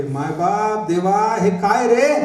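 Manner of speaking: drawn-out mock cry that swells at the end; stretched-out, acted cry of dismay; retold scream. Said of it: "जनक एकदम किंचाळे".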